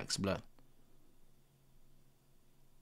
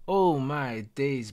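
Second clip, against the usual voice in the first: ordinary delivery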